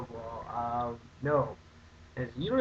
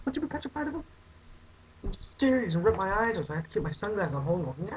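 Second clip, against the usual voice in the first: mock scared; 9 semitones higher than usual. Acting scared of Jeepers Creepers.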